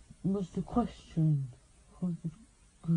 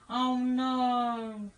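drawn-out oh no; 3 semitones higher, 7 dB louder than usual. Pretend. Repeated oh no, drawn out.

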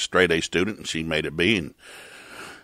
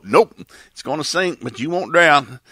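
light mock angel; 5 semitones higher, 3 dB louder, slower than usual. Angel answers Paul.

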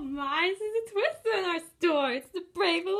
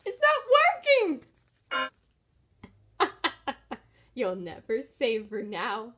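usual speaking voice

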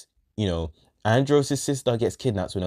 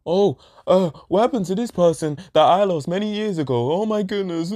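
lightly mocking melodrama; mock dramatic; 5 semitones higher than usual. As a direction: ham up a melodramatic lament. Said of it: "it is fucking predictable".

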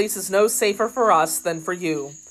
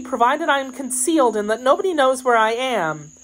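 higher, anxious maid's voice; 7 semitones higher than usual. Acted. Kitty asking to be kept concealed, anxious. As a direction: plead in a raised, girlish pitch.

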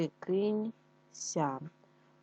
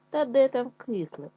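fox scoffing; 6 semitones higher, 6 dB louder than usual. A narrator's reading. Fox brushing off doubt.

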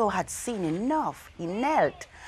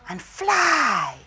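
soaring; 6 semitones higher than usual. A narrator's reading. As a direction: let it lift into the air. Climax of flight image.

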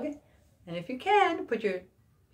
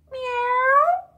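high, loud playful cat meow; mock meow; 7 dB louder than usual. Teacher meowing a hint for the kids. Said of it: "give you another hint".

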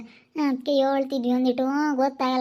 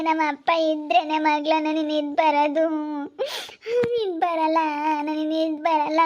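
put-on older woman consoling. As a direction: soothe the crying girl firmly.

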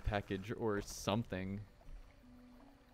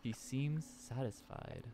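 story narration; 3 semitones lower than usual. A narrator's reading. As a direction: set the scene like a storyteller. Reads game narration aloud.

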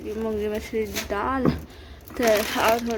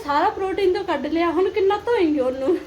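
dramatic loud aunty; 6 semitones higher, 4 dB louder than usual. Retold or imitated. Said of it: "ਕਹਿੰਦੇ".

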